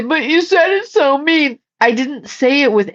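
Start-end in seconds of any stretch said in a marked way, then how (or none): 0.0-1.6 mock complainer. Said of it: "You can say".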